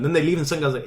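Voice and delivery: ordinary talk, nothing marked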